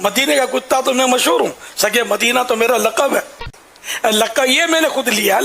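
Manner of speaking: normal talking voice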